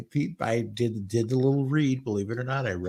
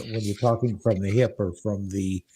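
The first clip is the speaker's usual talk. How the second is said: ordinary delivery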